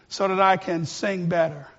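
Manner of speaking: usual speaking voice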